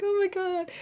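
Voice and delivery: high delighted gasp of recognition; thrilled to see an old friend; giddy oh my God; excited. Pretend. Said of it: "'cause you're like".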